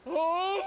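loud guttural cry; primal moan. Retold or imitated. Showing the weird noise from the psyche, loud cry. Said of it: "Something when you lived up a fucking tree".

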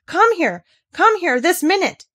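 put-on boy shouting to his friend in a rage. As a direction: yell for your friend. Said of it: "he shouted to Dickon".

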